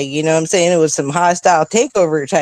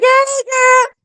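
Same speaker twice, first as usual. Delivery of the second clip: put-on much higher, sing-song "Hey, girl." in another woman's voice; friend's mom greeting. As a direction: answer back like a delighted old friend. Friend's mom answers the call.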